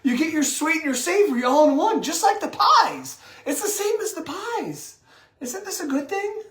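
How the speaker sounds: high, sing-song mock delight; sarcastic. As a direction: gush with fake enthusiasm. Mock enthusiasm about sweet hot dogs.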